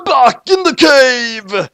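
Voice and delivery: slow, high, loud proclamation; dramatic announcer. A narrator's reading. Narrator announcing the cut to the cave. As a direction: boom out the scene change.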